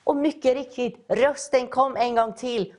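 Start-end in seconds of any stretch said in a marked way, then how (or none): none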